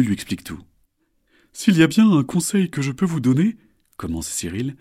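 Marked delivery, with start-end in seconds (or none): 1.5-3.6 high, cheerful crocodile; friendly crocodile voice. Acted. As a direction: greet them like a charming croc. Cyril gives advice.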